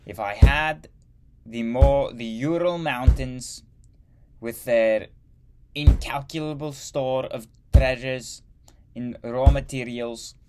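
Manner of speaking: loud, high-pitched mock German accent as Hitler; bad German accent. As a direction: declaim it like a ranting Hitler in a hammed-up German accent. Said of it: "That was a really bad accent".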